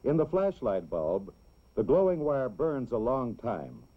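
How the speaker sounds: normal talking voice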